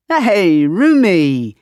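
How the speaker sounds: put-on cheery greeting in a heavy British accent; bright British welcome, arms spread; friendly roomie hello in thick British accent. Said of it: "he says in a heavy British accent".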